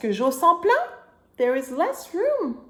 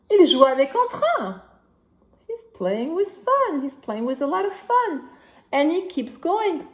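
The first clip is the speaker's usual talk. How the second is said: put-on reading to a toddler; 4 semitones higher, 3 dB louder than usual. Reading picture book to child.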